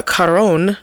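"Cuarón" with a trilled R; rolled R. Real, not acted. Host names the film's director, rolling the R in "Cuarón". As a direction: roll the R in "Cuarón". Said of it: "roll your R's".

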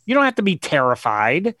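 usual speaking voice

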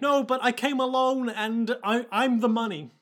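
put-on high-pitched young boy; unbroken boyish voice; Jacuzzi. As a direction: plead in a child's high voice. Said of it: "One whose voice is yet to break".

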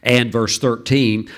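normal talking voice

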